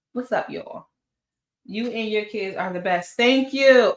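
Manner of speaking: normal talking voice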